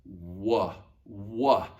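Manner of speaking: slow, drawn-out wa; consonant U. Shown as a demonstration. Teacher showing the consonant sound, slow wa. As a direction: say "wa" with rounded lips, like W. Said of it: "when it was a consonant".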